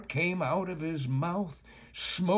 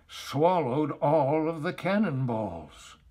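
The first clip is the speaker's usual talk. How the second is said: ordinary delivery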